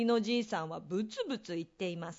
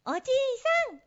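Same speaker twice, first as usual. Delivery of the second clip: plain reading voice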